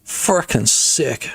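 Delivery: slow, drawn-out catchphrase. Shown as a demonstration. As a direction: drag out frickin' sick slowly. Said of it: "this has been my stim lately".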